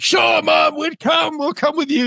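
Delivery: high, bright mock yes-mom voice; mock eager agreement. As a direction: play the eager sons humoring mom.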